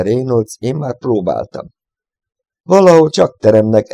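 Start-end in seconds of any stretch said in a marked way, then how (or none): 2.6-3.9 louder, higher insistent push; insisting. A narrator's reading. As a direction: insist firmly on the point. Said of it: "erősködött Lay". (hangos, erősködő kijelentés)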